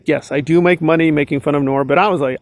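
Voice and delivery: ordinary delivery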